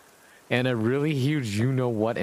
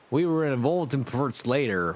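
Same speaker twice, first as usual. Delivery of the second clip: ordinary delivery, nothing marked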